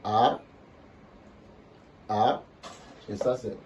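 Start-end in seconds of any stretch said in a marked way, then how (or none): 0.0-2.4 A climbing at the end; rising tone. Shown as a demonstration. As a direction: say "A" short, swept upward. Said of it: "Donc, ça fait".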